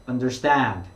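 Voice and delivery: understand with the stress on the last syllable, stand, drawn out. Shown as a demonstration. Teacher models understand with the third syllable stressed. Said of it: "뒤에 삼 음절 쪽에 강조를".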